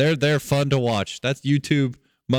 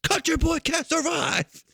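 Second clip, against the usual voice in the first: put-on quoting little kid. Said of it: "He's like".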